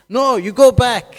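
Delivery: very loud, high-pitched command; stern shouted order. Acted. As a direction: shout her straight back home. Family orders daughter back.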